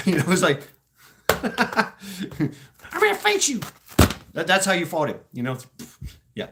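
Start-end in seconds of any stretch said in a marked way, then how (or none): 1.3-3.6 very high squeaky mock threat; tiny guy voice. Mocking the little marine's threat, squeaky high voice. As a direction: squeak it out like a pint-sized tough guy.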